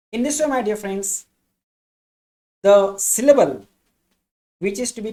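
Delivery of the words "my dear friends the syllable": usual speaking voice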